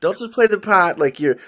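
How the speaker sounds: "pot" said Boston-style with no r, light imitation; a hint of Boston accent, "pot" for part. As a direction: quote him with a Boston "pot". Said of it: "with the Boston accent".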